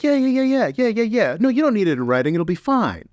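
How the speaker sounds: high careless yeah-yeah voice; dismissive brush-off. Retold or imitated. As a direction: wave him off, all fake reassurance. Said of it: "they're like".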